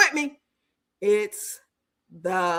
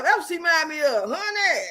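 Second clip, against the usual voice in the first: ordinary talk, nothing marked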